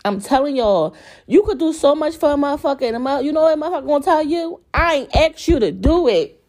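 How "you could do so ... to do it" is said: worked up. Real, not acted. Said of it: "I'm getting all, like, worked up".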